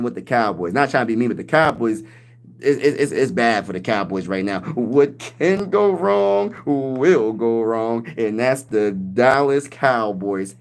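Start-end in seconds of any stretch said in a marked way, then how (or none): 4.7-10.6 very slow, drawn-out pundit verdict; Stephen A. Smith impression. Streamer imitating a pundit mocking the Cowboys, very slow. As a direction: drag it out like a TV pundit. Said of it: "Stephen A. Smith will probably make up and say".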